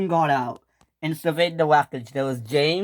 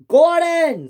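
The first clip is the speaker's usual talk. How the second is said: high, loud cry of a name; screamed call; 13 semitones higher, 6 dB louder than usual. Acted. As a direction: scream it out in alarmed reproach. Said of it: "screams Top Hat".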